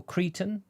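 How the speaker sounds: "cretan" said CREE-tun, long ee; pronunciation option. Shown as a demonstration. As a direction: say "cretan" with first syllable like "tree". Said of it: "A type of fabric pronounced".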